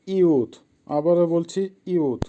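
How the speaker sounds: ইউথ spelled slowly and clearly twice; slow dictation. Shown as a demonstration.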